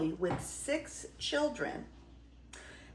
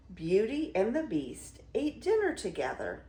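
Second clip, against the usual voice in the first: usual speaking voice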